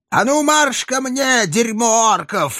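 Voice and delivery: high, barked rough command; hoarse bark. Acted. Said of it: "хрипло рявкнула всадница".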